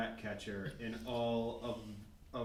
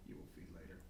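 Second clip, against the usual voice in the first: unvoiced, much softer conspiratorial whisper; creepy whisper; 15 dB quieter, much faster than usual. Character whispering to his pigeons, a hushed creepy promise. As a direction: whisper a secret promise to the birds.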